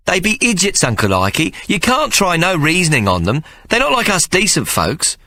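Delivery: put-on a small boy insisting it's not his fault; indignant young Tomo. Tomo protesting to his mother, high indignant child voice.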